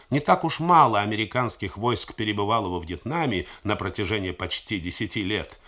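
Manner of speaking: ordinary delivery, nothing marked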